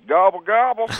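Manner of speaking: high, loud comic gobble; turkey gobble. Retold or imitated. Bush answers Kerry with a loud high gobble. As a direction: gobble back at the attacker. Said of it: "looks back at you and goes".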